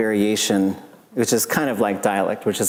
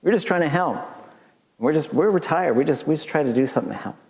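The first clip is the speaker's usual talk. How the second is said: lightly voiced older man, higher; a hint of a kindly old man; friendly elder quoted; retired helper; 6 semitones higher, faster than usual. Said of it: "And he says".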